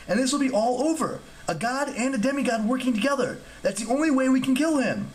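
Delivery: high-pitched, pleading Percy voice; rally an ally to fight with you; urgent young hero. Acted. Percy urges the god to help.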